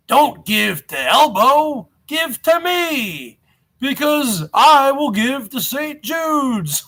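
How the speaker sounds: slow, drawn-out mock sales pitch; mocking scammer pitch. Streamer mimicking rival begging for donations, mocking slow pitch. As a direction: stretch the words, lifting high on "give to me". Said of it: "He was using Elbow as the impetus".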